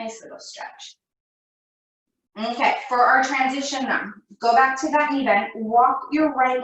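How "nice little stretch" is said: soft whispered aside. Real, not acted. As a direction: breathe it out under your breath. Quiet remark during stretch.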